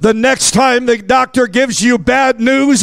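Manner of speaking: ordinary delivery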